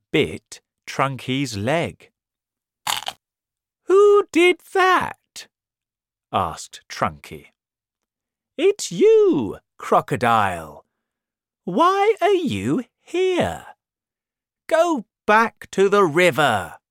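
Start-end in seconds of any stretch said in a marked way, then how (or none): 3.8-5.5 high, loud, drawn-out demand; storybook elephant's high outraged call; indignant elephant. Acted. Startled elephant confronts biter. 8.6-16.8 high, loud storybook telling-off; indignant, high-pitched scolding; scolding elephant. Acted. Elephant orders crocodile away.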